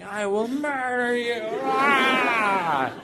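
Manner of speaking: hammed-up monster bear; mock bear threat. Imagined bear charging, mock threat shout.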